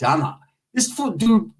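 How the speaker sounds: ordinary talk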